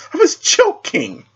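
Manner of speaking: very high, slow sing-song tease; playful teasing. Acted. Raven telling the knight she was only joking, high and teasing. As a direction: draw it out like a playful taunt.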